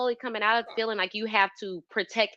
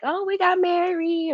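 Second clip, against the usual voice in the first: mock announcement; 10 semitones higher than usual. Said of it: "where they've like".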